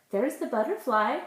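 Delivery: usual speaking voice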